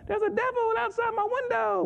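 high imitated child's voice; scared kid. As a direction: mimic a frightened child. Scared kids reporting to him.

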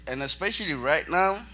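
fighting back tears; emotional. Real, not acted. Speaker moved by the young guys, emotional. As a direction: voice rising, choked with feeling. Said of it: "it's very emotional".

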